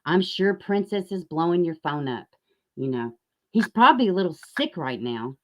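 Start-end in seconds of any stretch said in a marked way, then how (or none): none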